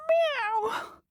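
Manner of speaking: high, thin cat meow. Acted. The dog meowing to the others, high and catlike. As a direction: mew like a little cat. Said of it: "said the dog".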